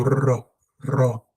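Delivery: "Ro" with a trilled R; "Ro" starting on a long rolled R. Shown as a demonstration. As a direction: vibrate the tongue on the R of "Ro". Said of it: "There must be a vibration of the tongue".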